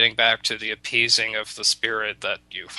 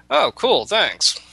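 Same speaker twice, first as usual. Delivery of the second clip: a father brushing off good news casually, pitched up; chirpy thanks; 10 semitones higher, 4 dB louder, slower than usual. Retold or imitated. Father thanking the magpie, mock high and breezy. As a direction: jump high on oh cool, bright and offhand.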